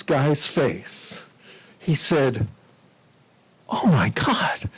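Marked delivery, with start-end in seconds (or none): 3.7-4.7 breathless whispered gasp of recognition; awed whisper. Retold or imitated. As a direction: whisper it like you can't believe your eyes. Stunned airline agent sees celebrity.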